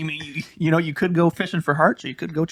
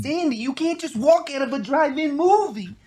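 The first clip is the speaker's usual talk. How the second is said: much higher whiny movie-greaser plea; Danny Zuko; 10 semitones higher than usual. Shown as a demonstration. Co-host showing off an impression, high Danny Zuko plea. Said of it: "You wanna hear my Danny Zuko?".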